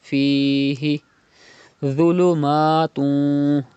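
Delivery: Fihi with long ya, dhulumatun slow and drawn; stretched vowel demo. Shown as a demonstration. Teacher demonstrates madd for students, drawn out. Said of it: "You have to pronounce by stretching".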